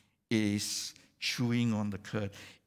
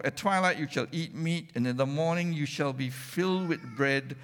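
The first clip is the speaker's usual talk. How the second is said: fervent preaching; 4 semitones higher, 4 dB louder than usual. Acted. Preacher proclaiming Exodus promise to congregation, fervent and loud. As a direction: preach the verses loud and high, with fire. Preaching at full force, reading scripture.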